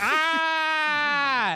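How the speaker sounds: mock triumphant yell; short hammed-up scream; imitated scream. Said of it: "it's like".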